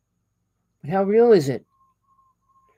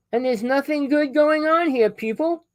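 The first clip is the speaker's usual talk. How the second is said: exasperated outcry; 11 semitones higher than usual. Real, not acted.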